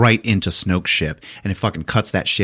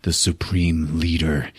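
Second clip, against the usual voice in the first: lower, quieter slow menace; menacing low line; 5 semitones lower, 6 dB quieter, slower than usual. Retold or imitated. Kylo chokes Hux, low slow menacing statement. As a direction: say it cold and threatening. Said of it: "Kylo goes".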